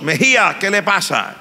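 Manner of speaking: teacher calling out. Retold or imitated. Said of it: "oí la voz".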